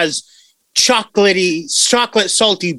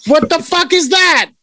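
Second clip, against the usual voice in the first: shocked; 10 semitones higher than usual. Real, not acted. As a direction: gasp out in shock. Reacting to three penises.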